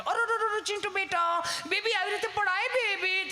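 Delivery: high cooing mock-mom voice; fussing mother. Doting mother fusses over her fallen child, high coo. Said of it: "mummy બિચારી આપણી પપડે".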